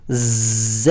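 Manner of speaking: za said with full force, far from plain talk; heavy za. Shown as a demonstration. Teacher showing the firm za sound. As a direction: press hard on ز. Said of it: "بالکل سختی کے ساتھ پڑھنا ہوگا".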